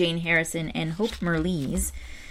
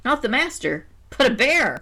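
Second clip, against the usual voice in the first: rising surprise on the reveal; spring the twist on them; surprised reveal; 6 semitones higher than usual. A narrator's reading. Narrator reveals bear to the mistress, surprised.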